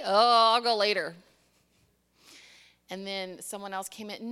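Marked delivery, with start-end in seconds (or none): 0.0-1.2 reluctant brush-off. Retold or imitated. Tired grandmother's brush-off, acted.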